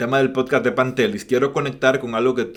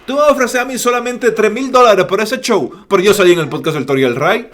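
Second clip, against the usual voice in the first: higher and louder, mock indignant star; haughty demand; 9 semitones higher, 8 dB louder than usual. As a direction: play the offended celebrity, loud and high. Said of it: "puede tener la oportunidad de decir".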